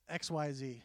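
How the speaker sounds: ordinary delivery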